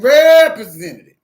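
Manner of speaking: "representative" blasted very loud; exasperated shout. Retold or imitated. Fed-up caller to the phone system, exasperated shout. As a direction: lose patience and holler it.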